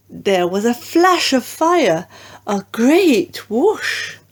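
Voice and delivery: usual speaking voice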